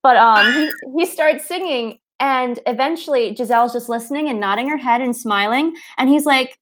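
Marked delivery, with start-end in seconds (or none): none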